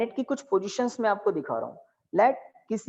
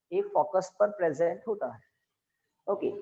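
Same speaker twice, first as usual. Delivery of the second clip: usual speaking voice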